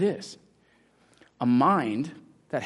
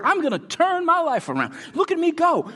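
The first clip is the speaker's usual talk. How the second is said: much higher, louder gleeful boast; mock eager paddler; 4 dB louder than usual. Preacher acts someone paddling hard, high gleeful boast. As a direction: cheer yourself on while paddling.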